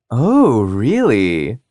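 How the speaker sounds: high, playful teasing "Oh, really". Acted. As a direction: tease him with a sing-song lilt. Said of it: "Tabby teased".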